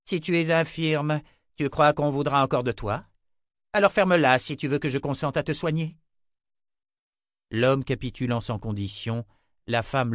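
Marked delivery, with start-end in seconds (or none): 0.0-6.0 cold threat. Acted. Sister threatens subordinate.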